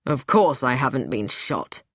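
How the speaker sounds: a hint of annoyance; man brushing off relief, mildly irritated; a little show of anger. A narrator's reading. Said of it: "with a little show of anger".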